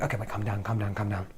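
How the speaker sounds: soft, quiet self-soothing; a hushed calm-down to oneself. Retold or imitated. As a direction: talk yourself down gently.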